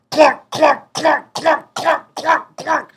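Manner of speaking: high, loud mock clunks; comic sound effect of skulls knocking; clunking sounds. Imitating heads knocking together.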